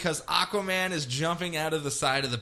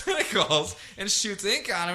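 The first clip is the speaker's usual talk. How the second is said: ordinary talk, nothing marked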